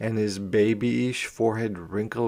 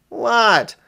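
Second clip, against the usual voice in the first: put-on boy calling back; 11 semitones higher, 6 dB louder than usual. A narrator's reading. Brother answers from his bed.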